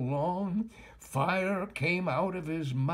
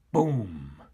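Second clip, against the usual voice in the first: cannon boom; 8 semitones lower, 3 dB louder than usual. A narrator's reading. Cannon fires.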